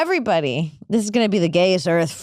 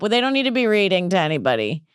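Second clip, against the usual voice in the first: ordinary delivery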